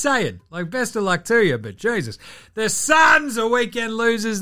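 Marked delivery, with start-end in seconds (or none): 2.5-4.4 high, loud, slower shout; loud slow announcement of the losers; loud declaration. Real, not acted. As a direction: shout the verdict.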